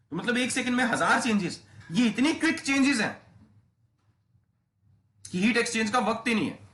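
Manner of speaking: excited teacher stressing how quick the changes are; emphatic excitement. Real, not acted. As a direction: drive the point home with energy.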